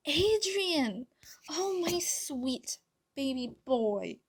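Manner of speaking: high, airy, gushing mother's voice; doting mother. A narrator's reading. Emily phoning her long-lost son, gushing. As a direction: coo to a long-lost child, drawn out and high.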